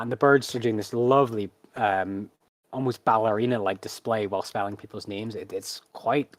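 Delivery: normal talking voice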